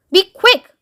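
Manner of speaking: high, pressed command; impatient order. Acted. President hurrying the chief interpreter, impatient order. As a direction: hurry him along, high and loud.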